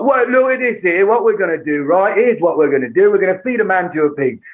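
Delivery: hammed-up East End crime boss accent; London gangster accent. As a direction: play a menacing Cockney villain. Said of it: "If I was married to Guy Ritchie".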